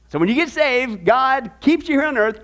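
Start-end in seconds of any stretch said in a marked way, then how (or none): none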